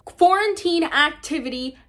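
slow, loud, high title call; loud announcement. Real, not acted. Vlogger introducing quarantine activity three, loudly announced. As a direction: announce it big, word by word.

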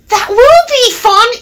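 very high, perky character voice; eager freight car. A narrator's reading. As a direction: chime in with a high little voice. Eavesdropping freight car chimes in.

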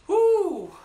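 high, loud celebratory whoop; triumphant whoop. Real, not acted. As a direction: cheer as the set ends. Finishing the squat set.

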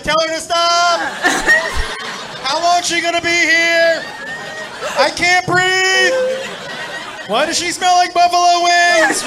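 buddy pinned under a big woman, pleading to friends; mock desperate plea. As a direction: beg to be rescued, half-choked. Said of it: "He would be like".

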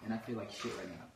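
ordinary talk

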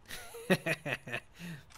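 short mocking laugh; gloating laugh. Real, not acted. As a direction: laugh at the fallen enemy. Laughs at kill.

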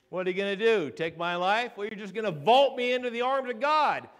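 building to a loud, high proclamation; fired-up preacher; defiant preaching. Real, not acted. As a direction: dare them, then proclaim it triumphantly. Preaches at full force.

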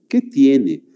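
ordinary talk

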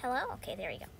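ordinary talk

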